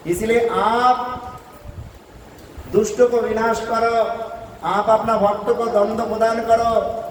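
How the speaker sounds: high, declaimed entreaty; pleading wives. Acted. Serpent's wives beg mercy for the maidservants, pleading high. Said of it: "रो रो के कहने लगे".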